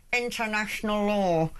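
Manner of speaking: biting down on pain; through gritted teeth. Shown as a demonstration. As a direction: spit it out through clenched teeth. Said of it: "I'll say it through gritted teeth".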